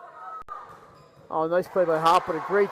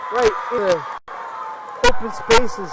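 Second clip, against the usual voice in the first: excited call; 3 semitones higher, 8 dB louder, slower than usual. Real, not acted. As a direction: ride the excitement up. Live play-by-play winning hit.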